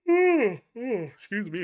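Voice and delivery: stretched out mid-yawn; very slow yawned apology. Real, not acted. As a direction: talk through a big yawn. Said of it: "I keep yawning".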